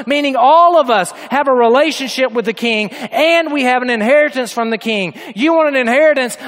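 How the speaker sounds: high, loud, driving sermon delivery; impassioned preaching. Acted. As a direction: pulpit crescendo held at high pitch. Preacher rises to full force.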